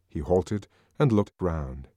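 plain reading voice

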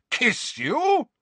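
high-pitched astonished repeat; incredulous. A narrator's reading. Uncle repeating the baffling answer, incredulous. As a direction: echo it as if it were absurd. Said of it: "his eyes popping slightly".

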